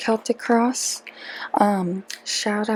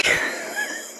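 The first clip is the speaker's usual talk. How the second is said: high squeaky giggle; 5 dB quieter than usual. Real, not acted. Vlogger giggling after startling herself, high squeaky giggle. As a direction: giggle pitched way up, tapering off.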